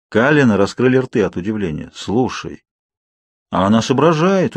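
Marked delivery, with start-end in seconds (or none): 1.9-4.5 a touch of amazed approval; surprised. A narrator's reading. Emmett discovering the girl can think, surprised. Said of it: "удивился Эммет".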